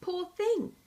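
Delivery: hollow, put-on 'poor thing'; unconvincing sympathy. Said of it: "he added unconvincingly".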